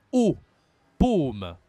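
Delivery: O and paume with a closed, rounded vowel, loud; circumflex ô. Shown as a demonstration. Teacher shows the circumflex sound, loud closed O in paume. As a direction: punch a long closed O in paume. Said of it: "si je mets accent circonflexe, ça devient".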